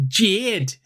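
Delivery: "Jade" said the Geordie way, vowel close to "Jid"; northern Geordie accent. Shown as a demonstration. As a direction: say "Jade" like a Newcastle girl. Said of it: "It's a very northern way of saying Jade".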